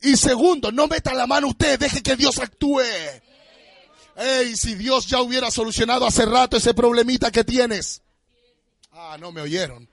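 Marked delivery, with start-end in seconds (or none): 0.0-8.0 high, loud, half-sung preaching over music; full-force preaching. Acted. Pastor rousing the congregation over the music, shouted and sung. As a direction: shout it to the rafters.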